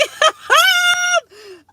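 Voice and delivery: high shrieking cry of laughter; screaming laugh. Real, not acted. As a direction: let out a loud shrieking laugh. Laughing at Hope's wail.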